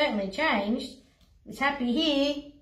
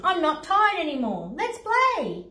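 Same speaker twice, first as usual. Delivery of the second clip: excited lion; 7 semitones higher, 3 dB louder than usual. Acted. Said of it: "lion playful".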